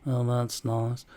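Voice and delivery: slow, badly faked Liverpool accent; attempted Liverpool accent. Shown as a demonstration. Acting out a chat with George, badly attempted Liverpool accent. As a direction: act out a little exchange in dodgy Scouse. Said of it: "I can't fucking do it".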